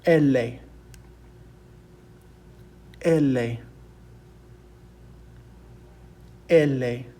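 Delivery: "ele" as "EH-leh", said singly with falling tone; Spanish letter name. Shown as a demonstration. Teacher having students repeat Spanish L, clear "ele". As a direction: model "ele" clearly in two syllables. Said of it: "which is pronounced".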